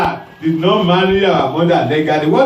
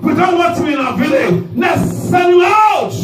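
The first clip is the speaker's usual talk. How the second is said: villagers shouting; 12 semitones higher than usual. Acted. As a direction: yell him out of the village. Villagers rejecting Japheth.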